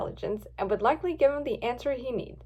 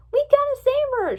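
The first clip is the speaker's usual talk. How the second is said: high, loud rallying cry; raised, high-pitched urgency; urgent shout; 13 semitones higher, 7 dB louder than usual. A narrator's reading. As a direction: shout to rally your friends. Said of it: "shouted Rainbow Dash".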